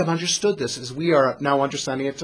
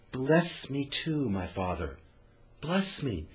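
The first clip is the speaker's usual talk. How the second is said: mild anguished plea; 3 semitones lower, 10 dB quieter, slower than usual. Retold or imitated. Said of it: "an exceedingly anguished and bitter cry".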